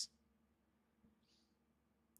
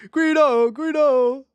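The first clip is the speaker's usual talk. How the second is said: louder, higher call of Guido; 8 semitones higher, 7 dB louder, slower than usual. Real, not acted. Calling to her friend Guido across the room.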